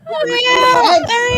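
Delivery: high, loud triumphant yell; hammed-up shout. Playing along with the bit, high loud yell. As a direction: belt it out like you spotted him.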